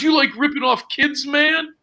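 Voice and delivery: high-pitched imitated accusation; mock aggrieved caller. Retold accusation by aggrieved young caller.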